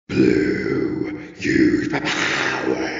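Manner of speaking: low, slow eerie whispering voice; a sinister spirit beckoning; evil voice. A narrator's reading. The evil voice calling Blue in the night, menacing.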